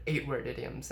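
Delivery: usual speaking voice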